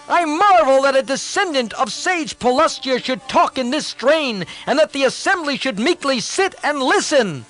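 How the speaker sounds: proud son bristling with contempt; hot-headed prince bursting out in scorn; impatient outburst. Acted. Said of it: "lost patience and burst out".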